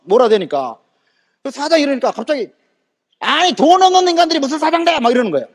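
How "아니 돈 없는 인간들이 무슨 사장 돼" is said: high, sustained sneering shout; contemptuous outburst. Retold or imitated. Man sneers at poor listeners.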